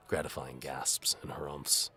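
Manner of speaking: plain reading voice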